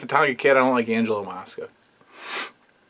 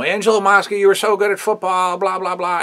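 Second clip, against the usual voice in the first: imitating Piper; 8 semitones higher than usual. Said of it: "like, you know".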